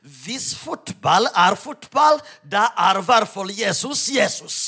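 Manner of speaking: slow, loud, emphatic echo of the retort; bold comeback. Retold or imitated. Preacher turning back on the football fan, bold comeback. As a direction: throw it back boldly, landing hard on "Jesus". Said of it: "snudde jeg med frimodigheten til han tilbake".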